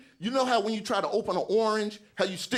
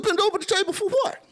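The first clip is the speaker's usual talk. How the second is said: much higher, louder, outraged question; shocked squeal; 12 semitones higher, 4 dB louder than usual. Retold or imitated. Patient answering the doctor's order, in high shocked disbelief. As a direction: yelp it in disbelief, the pitch shooting up.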